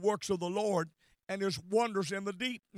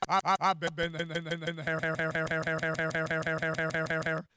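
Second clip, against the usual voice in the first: stuck, repeating; 4 semitones lower, faster than usual. Real, not acted. Stuttering repeated words, low far voice.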